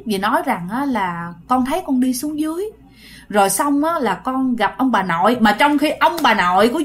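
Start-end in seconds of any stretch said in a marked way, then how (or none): none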